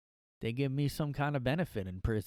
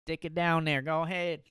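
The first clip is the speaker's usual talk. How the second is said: put-on faded southern girl voice; 5 semitones higher, 4 dB louder than usual. Echoing the put-on voice lower.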